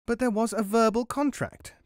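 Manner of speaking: high-pitched, indignant mouse protest. A narrator's reading. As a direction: object in a high little voice. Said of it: "protested Guru".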